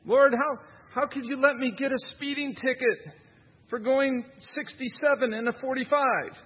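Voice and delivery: high, pleading mock complaint to God; imitating a speeding driver griping at God; mock whiny prayer. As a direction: lightly ham up a self-pitying plea. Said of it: "blaming God for it".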